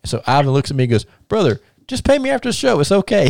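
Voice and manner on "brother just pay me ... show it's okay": high-pitched mock easygoing quote; put-on high voice, all reassurance; wrestler suddenly agreeable. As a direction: imitate a wrestler who's suddenly fine with it. Retelling wrestler's sudden change of tune.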